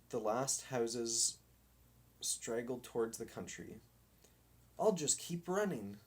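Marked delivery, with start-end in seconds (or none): 4.8-6.0 lightly breathless, a bit higher; a touch of breathless running; mild panting. A narrator's reading. Dog tells himself he'll run away, a little breathless. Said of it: "he panted".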